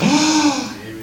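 high, sudden gasp of amazement; acted awestruck gasp; awed gasp. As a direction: catch the breath in wonder. Acts amazement at the sacrifice.

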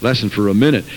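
loud pulpit preaching; full-voiced sermon delivery. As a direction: drive it home to the pews. Preaching to congregation.

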